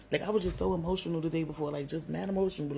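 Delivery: ordinary delivery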